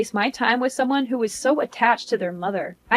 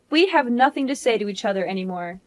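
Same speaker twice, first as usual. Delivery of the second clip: normal talking voice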